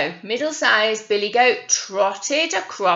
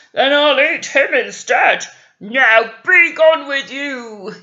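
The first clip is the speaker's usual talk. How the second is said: roaring troll; 3 semitones higher, 9 dB louder than usual. A narrator's reading. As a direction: bellow the goat off the bridge.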